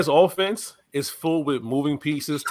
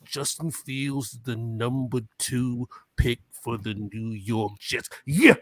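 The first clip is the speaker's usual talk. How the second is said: Macho Man Randy Savage; 4 semitones lower, 6 dB quieter than usual. Acted. Podcaster announcing the Jets' pick, wrestler impersonation. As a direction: announce the pick as a brooding pro wrestler, then punch the catchphrase. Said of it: "Macho Man Randy Savage impersonation".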